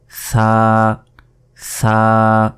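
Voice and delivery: সা begun with tongue between teeth, vowel held. Shown as a demonstration. Teacher demonstrating where the tongue goes, lisped th. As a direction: say সা as a long "thaa". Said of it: "জিহ্বা আগেই বের করবেন".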